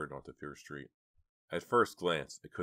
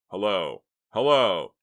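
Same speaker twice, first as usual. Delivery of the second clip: loud, urgent calling hello; 4 semitones higher, 13 dB louder, slower than usual. Acted. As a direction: shout into the phone. Calling into dead phone line.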